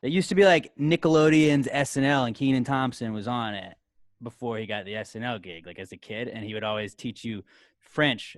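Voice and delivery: normal talking voice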